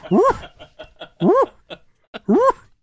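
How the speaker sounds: high, loud woofing laugh; mom's barking laugh. Retold or imitated. Mom laughing at him from the living room, high barking woofs. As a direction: bark out a laugh like a dog. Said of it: "And she's like".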